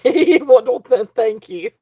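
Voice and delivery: real giggle breaking up the words; laughing. Real, not acted. Seller thanking someone in the live chat, laughing. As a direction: stumble over the thanks while laughing.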